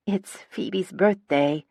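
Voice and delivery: slow, hesitant, mildly shaky plea. A narrator's reading. Gemma finally speaks up on birthday, shaky. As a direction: falter slightly, pleading gently. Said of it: "Gemma's voice is shaky".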